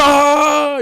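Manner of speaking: high, loud frustrated scream; screaming aaah. Retold or imitated. Retelling the guy who lost his gacha pull, raging scream. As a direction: let out a raging scream of defeat. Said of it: "He's like".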